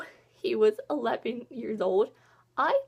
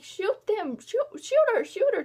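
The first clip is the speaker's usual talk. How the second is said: urgent order; 9 semitones higher, 3 dB louder than usual. Retold or imitated. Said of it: "June's like".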